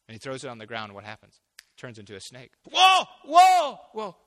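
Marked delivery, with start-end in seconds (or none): 2.7-4.2 much higher, louder frightened yelp; startled shout. Retold or imitated. Moses recoiling from the snake, shouting in alarm. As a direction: jump back from the snake with a cry.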